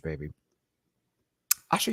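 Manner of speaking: ordinary talk, nothing marked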